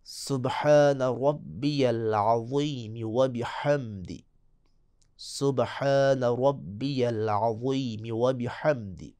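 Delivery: drawn-out Arabic recitation of سبحان ربی العظیم و بحمدی; slow Quranic-style recitation; slow tajweed recitation. Shown as a demonstration. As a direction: recite slowly, each letter articulated. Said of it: "tajweed teacher demonstrating dhikr".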